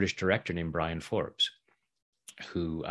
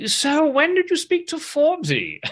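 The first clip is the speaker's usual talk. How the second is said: imitating Attenborough; 7 dB louder than usual. Attenborough voiced in retold story.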